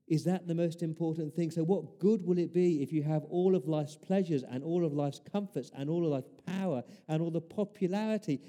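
usual speaking voice

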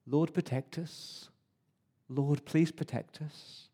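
quiet prayer. Retold or imitated. Mum prays over the doorpost.

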